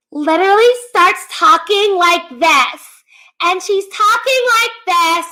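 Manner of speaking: very high, loud put-on squeak; mock baby voice. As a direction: imitate a woman's exaggerated baby voice. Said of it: "kind of baby voice".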